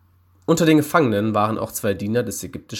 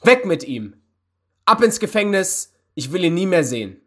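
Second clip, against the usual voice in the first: high, raised angry orders; angry master banishing a servant; furious shout; 8 semitones higher, 4 dB louder than usual. A narrator's reading. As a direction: order him taken away in a rage. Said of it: "schrie er wütend".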